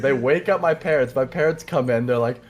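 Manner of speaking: normal talking voice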